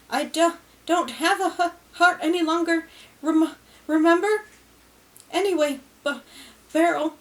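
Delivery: a nervous dead husband finally getting his say; stammering Ron. A narrator's reading.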